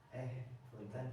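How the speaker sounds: soft, humble polite plea; pleading. Shown as a demonstration. Teacher demos a person pleading to contrast anger, soft humble plea. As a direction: ask meekly, begging pardon. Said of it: "orang yang memohon".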